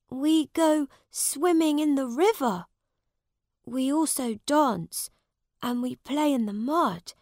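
a hint of young Tom; humble boy Tom. Tom describes summer fun.

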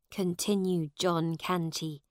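ordinary delivery, nothing marked

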